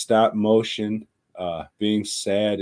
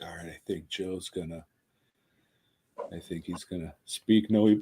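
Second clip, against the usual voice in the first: much softer, quick, under the breath; inner thought; 6 dB quieter, faster than usual. Retold or imitated. Retelling his guess of Joe's pick, quick and hushed. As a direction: rush it quietly like thinking aloud.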